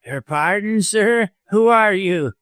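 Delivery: high-pitched suspicious snarl. A narrator's reading. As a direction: demand it with a kind of snarl. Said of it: "with a kind of snarl in his voice".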